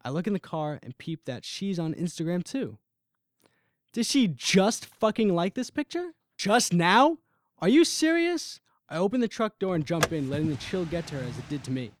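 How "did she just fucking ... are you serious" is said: disbelief. Acted. Finds she liked the photo.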